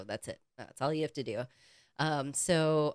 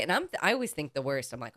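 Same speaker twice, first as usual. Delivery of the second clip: ordinary talk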